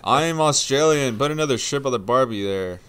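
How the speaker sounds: loud, hammed-up Australian accent. Shown as a demonstration. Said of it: "Here's my Australian accent".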